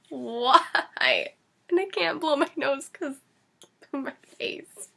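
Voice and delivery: usual speaking voice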